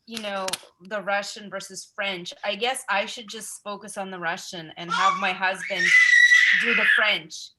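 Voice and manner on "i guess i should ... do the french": laughing through a joke about her husband; half-laughing, with the end louder; tossed off with a laugh. Real, not acted.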